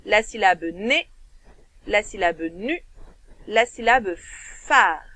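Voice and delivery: "né", "nu", "phare" each set apart with a pause; teacher breaking a word into syllables. Shown as a demonstration. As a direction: split "nénuphar" into its three syllables for children. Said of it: "trois syllabes".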